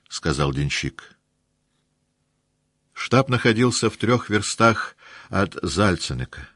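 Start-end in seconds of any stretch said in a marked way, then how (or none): none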